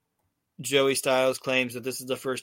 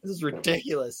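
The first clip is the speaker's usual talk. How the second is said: ordinary delivery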